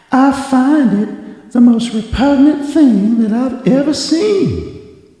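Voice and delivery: put-on high, slow, drawn-out Southern belle accent; mock a swooning Southern belle, slowly and high; Southern belle Scarlett O'Hara. Mocking a friend's prissy line in a Southern belle accent. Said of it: "You sound like, Scarlett O'Hara".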